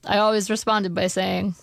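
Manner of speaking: ordinary delivery, nothing marked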